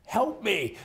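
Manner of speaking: high-pitched retold plea for help; mock plea. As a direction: pitch it up as an exasperated caller. Acts out a frustrated phone call.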